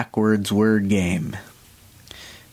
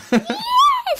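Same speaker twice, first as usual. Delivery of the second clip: high delighted laugh; 3 dB louder than usual. Real, not acted. Delighted at winning game.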